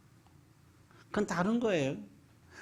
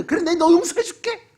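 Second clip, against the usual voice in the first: much higher and louder, spat out through anger; grudging angry forgiveness; 11 semitones higher, 9 dB louder than usual. Retold or imitated. Preacher mocks someone forgiving in a rage, loud and high. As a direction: forgive while clearly fuming. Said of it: "그러는 거".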